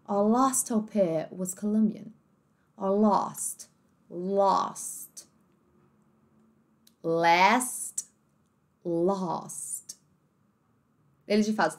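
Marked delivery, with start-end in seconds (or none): 0.1-10.0 slow British read with an open a in "last"; British accent. Shown as a demonstration. Teacher reading the sentence in a British accent, slow. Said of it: "Olha como que fica essa frase no inglês britânico".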